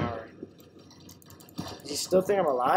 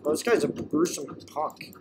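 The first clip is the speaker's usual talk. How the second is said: normal talking voice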